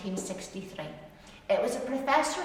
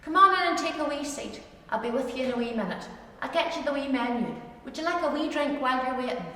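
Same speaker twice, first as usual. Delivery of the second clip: put-on bright, quick Ulster waitress welcome in ulster scots accent; 4 semitones higher than usual. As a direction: greet customers like a chatty Ulster server, ulster scots accent. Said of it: "coffee shop or a restaurant in Ulster".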